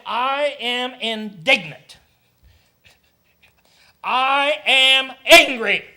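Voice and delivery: slow, loud and stern as an angry God; booming divine anger, drawn out. Acted. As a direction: thunder it out slowly as God's indignation. Preacher voicing God's anger.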